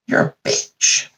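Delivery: slow, voiceless mock contempt; disgusted whisper. God giving up on Adam, whispered disgust. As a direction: whisper it slowly as a verdict.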